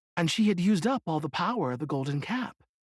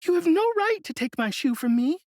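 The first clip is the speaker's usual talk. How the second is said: put-on higher girl's voice, indignant; little girl crying out; 13 semitones higher, 4 dB louder than usual. A narrator's reading. Dorothy scolding the witch who stole her shoe, indignant. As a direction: protest up high like a wronged child. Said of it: "cried Dorothy".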